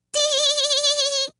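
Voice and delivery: very high, loud tee-hee; little woman's piping giggle; high tittering laugh. A narrator's reading. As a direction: titter with delight. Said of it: "she laughed".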